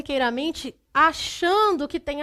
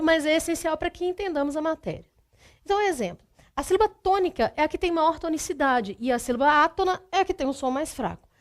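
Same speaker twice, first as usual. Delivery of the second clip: ordinary delivery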